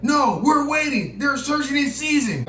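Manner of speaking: high, slow shouted cop voice; mock cop answer. Cop telling them to wait: slow high voice. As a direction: drag it out like the cop. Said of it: "He like".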